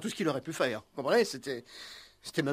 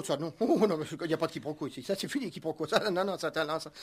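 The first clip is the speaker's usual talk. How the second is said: nervous rattled denial; 4 dB quieter, faster than usual. Acted.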